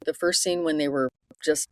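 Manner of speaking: ordinary talk, nothing marked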